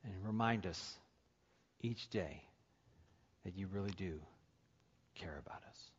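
soft, low closing prayer; quiet prayer. Real, not acted. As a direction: pray gently over the congregation.

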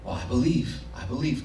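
quiet, smug "I believe"; lightly voiced smug churchgoer; mock complacent believer. Preacher mocking passive believers, quiet and smug. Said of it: "The reward does not come from just believing".

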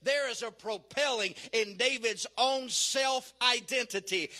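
ordinary talk, nothing marked

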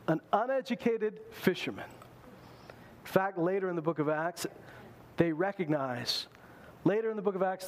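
ordinary talk, nothing marked

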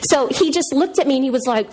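ordinary talk, nothing marked

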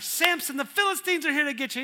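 high, loud, fast warning cry; mock alarm. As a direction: yell to wake him, hammed up. Delilah alarm third time.

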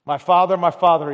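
king crying out loudly to the dying prophet; a loud, pleading cry of father; cried out. A narrator's reading. King pleading with the dying prophet, loud cry. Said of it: "he cried".

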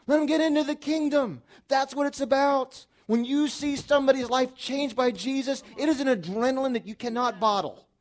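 loud, high, driving preacher's climax; full-force preaching. Acted. Pastor pleading with the congregation at full voice. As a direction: preach it out with rising fire.

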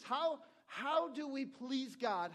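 slow emphatic question. Real, not acted. Pastor presses rhetorical questions.

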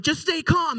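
high-pitched mock-frantic calming; urgent squeaked reassurance; panicked reassurance. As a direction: act out frantically telling someone to calm down. Panicking while telling mom stay calm.

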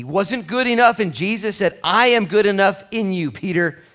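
preaching at full force. Acted. Preacher urging the congregation not to look down on people, in full-force preaching. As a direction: preach it hard to the whole room.

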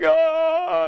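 high mock baby scream, imitating; a short squealed "ah" like a frightened baby. Preacher acting out Bob's terror at the snake, high mock scream. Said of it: "he's kinda screaming like a baby".

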